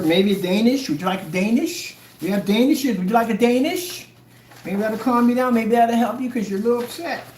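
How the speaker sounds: put-on singsong babying taunt; mock solicitous. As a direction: fuss over them with fake concern. Mock soothing offer to upset sellers.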